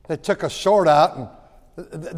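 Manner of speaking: ordinary delivery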